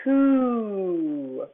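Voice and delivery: "Two" held as one long drawn-out syllable; sounding out. Shown as a demonstration. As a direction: stretch "Two" out slowly. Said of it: "sound out big words".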